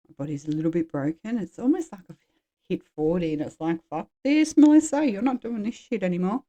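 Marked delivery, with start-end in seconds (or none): 3.9-6.4 much higher, louder mock voice of her own worn-out body; her fed-up body. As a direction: give her body a sassy, exasperated voice. Her body talking at forty.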